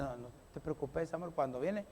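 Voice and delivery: soft, low, doting promise; husband doting on wife with roses; loving reassurance. Pretend. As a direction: reassure her tenderly.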